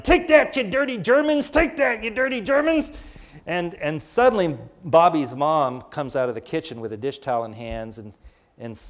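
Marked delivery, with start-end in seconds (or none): 0.0-2.9 put-on high, loud playful shout of a boy at war; kid's battle yell. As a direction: yell it like a ten-year-old ambushing toy soldiers. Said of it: "I'm yelling".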